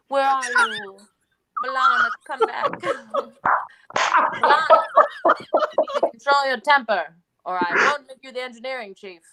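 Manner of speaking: captain calling out to her crew; hammed-up scolding in an Iowa-British accent; Captain Janeway. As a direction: call out theatrically, then lay down an order. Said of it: "can you only do her introducing herself?".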